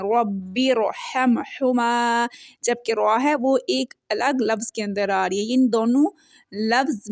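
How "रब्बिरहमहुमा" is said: slow, drawn-out tajweed chant; Quranic recitation. Shown as a demonstration. Teacher recites the verse for her students, slow chant. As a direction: recite the verse slowly, stretching each vowel. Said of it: "ज़ेर के ज़रिए रॉ साकिन को मिला रहे हैं".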